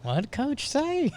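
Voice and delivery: high, slow, laughing through words. Real, not acted. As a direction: barely get it out.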